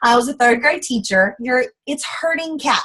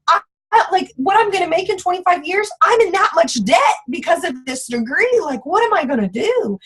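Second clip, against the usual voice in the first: high, frantic rush of worry; retold panic, pitched high; freaking out; 8 semitones higher than usual. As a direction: spiral about the debt. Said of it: "And I'm freaking out".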